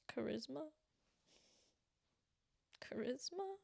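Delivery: high unvoiced questioning charisma; whispered hopeful question. Real, not acted. Asking viewers about her D and D stats, whispered. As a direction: whisper it hoping someone agrees.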